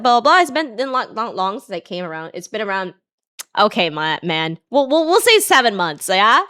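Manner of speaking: normal talking voice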